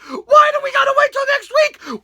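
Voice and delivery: very high, outraged squawk; hammed-up angry yelling; pissed-off crowd. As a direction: voice the furious fans. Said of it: "People were pissed!".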